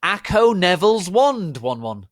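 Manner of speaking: loud, high shouted incantation; spell cry. Acted. Voldemort summoning Neville's wand, loud spell cry. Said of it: "cried Voldemort".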